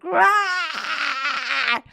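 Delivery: long, high mock scream at held-back volume; drawn-out, strained "aah" of release. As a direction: imitate her cathartic scream without full loudness. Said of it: "she let out that scream".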